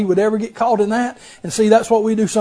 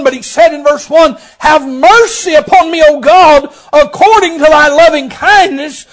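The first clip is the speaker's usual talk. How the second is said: building to a high, loud preacher's plea; full-force preaching; 9 semitones higher, 7 dB louder than usual. Acted. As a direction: cry out the psalm to the congregation.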